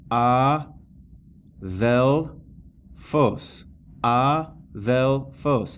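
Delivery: "a, vel, fos" with a pause after each syllable. Shown as a demonstration. Teacher counting syllables for students, syllable by syllable. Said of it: "That one has three syllables".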